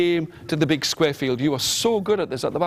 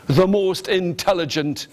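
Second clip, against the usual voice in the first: plain reading voice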